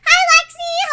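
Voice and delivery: very high, loud hello; high-pitched burst of hello; squealed greeting. Real, not acted. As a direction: greet them in an excited squeal. Squealed greeting before mommy voice.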